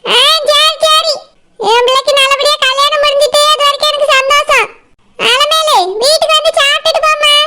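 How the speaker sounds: high-pitched mother's voice giving in; relenting mother. Acted. Mother inviting her daughter's friend to eat, relenting. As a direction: give in, then invite in a high voice.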